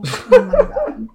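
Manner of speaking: high, slow laughing cry. Real, not acted. Owner watching the dog pee on the sidewalk, laughing high. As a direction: squeal it through a laugh.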